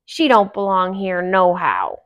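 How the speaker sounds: loud, scornful grouch; gruff old dwarf warning the others off; cranky dwarf ranting about females; grumpy dwarf. A narrator's reading. Grumpy voiced.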